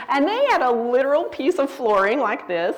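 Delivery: says it through a real laugh; laughing as she holds up the flooring. Real, not acted. Explaining the family tradition to the women, laughing.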